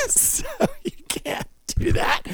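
high, soft, wheezing laugh through the words; real laughter. Real, not acted. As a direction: squeeze the words out while laughing. Cracks up at own joke.

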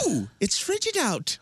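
put-on very high, snooty spoiled rich kid; entitled rich kid. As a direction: whine like a pampered 1800s heir. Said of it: "sound like a rich kid in a hot air balloon".

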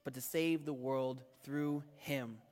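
low, measured Bible reading; scripture reading. Acted. Pastor reads the verdict to the church, measured scripture reading.

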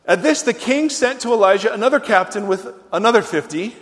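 normal talking voice

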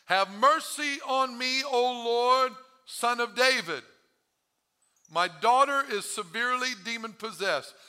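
loud, high plea to the Lord; crying out. Acted. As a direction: cry out for mercy, drawn out. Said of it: "cried out to him".

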